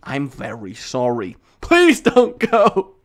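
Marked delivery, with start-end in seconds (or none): 1.6-2.9 loud, slow "please" dragged out; hammed-up plea to stay; begging viewers not to leave, mock pleading.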